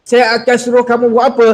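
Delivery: usual speaking voice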